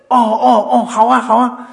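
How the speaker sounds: loud, high string of ohs; hasty agreement. Retold or imitated. Host humouring crying visitor, loud hasty agreement. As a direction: agree eagerly to placate them.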